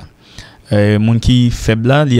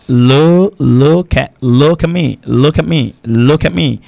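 demanding repeated look at me; commanding look at me; 3 semitones higher, much slower than usual. Shown as a demonstration. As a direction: call out "Look at me" like an order.

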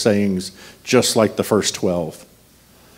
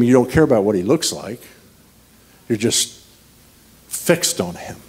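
ordinary delivery

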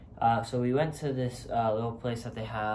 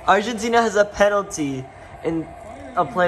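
excited; 9 semitones higher, 8 dB louder than usual. Real, not acted. Live match excitement.

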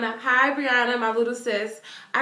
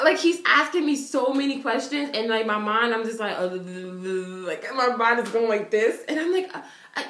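ordinary talk, nothing marked

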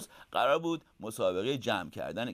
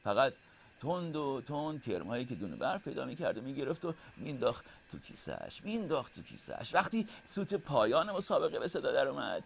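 ordinary delivery

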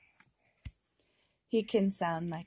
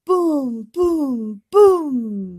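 loud booming thunder; big loud thunder booms; 4 semitones higher, 14 dB louder, much slower than usual. Pretend. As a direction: boom each word like thunder. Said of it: "Mister Brown can make thunder".

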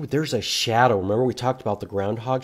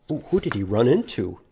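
ordinary talk, nothing marked

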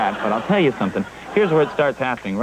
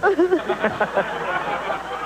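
mocking laugh; 13 semitones higher than usual. Host laughs at guest.

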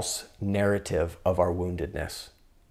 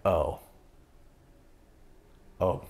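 softened realization; 2 semitones lower, 3 dB quieter than usual. Retold or imitated. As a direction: let it land slowly with a pause. Realises it is his child self.

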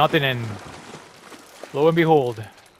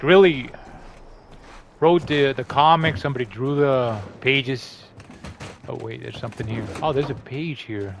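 ordinary delivery

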